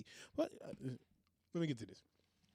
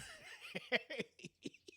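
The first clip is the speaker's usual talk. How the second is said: long squeaky fit of giggles; real high-pitched giggling fit; 10 dB quieter than usual. Real, not acted. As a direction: break into helpless high giggles. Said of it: "If I can stop laughing".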